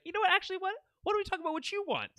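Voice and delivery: high, rushed mock deflection; squeaky-high, hurried backpedaling; flustered stalling. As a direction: imitate someone hastily changing the subject. Stumbling mayor dodges reading the offer.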